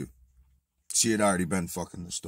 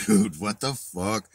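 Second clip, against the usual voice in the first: louder, slow, drawn-out disbelief; incredulous outburst; 3 semitones higher, 7 dB louder, slower than usual. Retold or imitated. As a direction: push it out like you can't believe it. Incredulous retort to old man.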